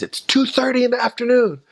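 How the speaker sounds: high-pitched mock yell of an irritated neighbor; retold angry scream. Woken neighbor yelling at missionaries at his door, high-pitched angry mock yell. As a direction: shriek it like a woken man in pajamas. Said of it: "he just screamed at us".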